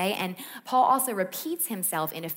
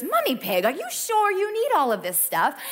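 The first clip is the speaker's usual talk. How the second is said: higher, booming cartoon bull; Mister Bull voice; 7 semitones higher, 4 dB louder than usual. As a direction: play Mister Bull loud and high. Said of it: "and he goes".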